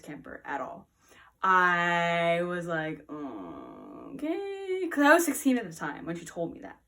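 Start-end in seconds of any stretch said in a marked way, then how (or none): 3.1-4.9 low, slow, unenthused okay; reluctant okay. Retold or imitated. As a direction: drag it out grudgingly. Reluctant reaction to pregnancy.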